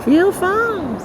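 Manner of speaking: put-on high elderly voice echoed from memory; old woman. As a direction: recall her words in her high old-lady voice. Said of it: "I'm a hundred and four".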